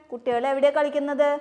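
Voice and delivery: usual speaking voice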